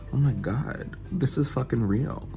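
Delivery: ordinary talk, nothing marked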